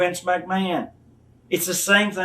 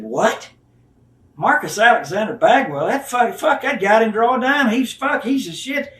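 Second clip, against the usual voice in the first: mock outrage; 6 semitones higher than usual. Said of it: "He said".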